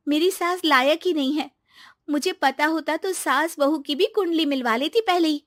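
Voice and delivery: put-on aggrieved young wife confiding; chummy daughter-in-law. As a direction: gossip sweetly about your mother-in-law.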